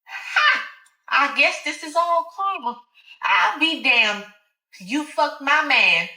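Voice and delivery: high, slow, mocking taunt; taunting Alice. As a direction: savour the revenge. Alice revealing her revenge.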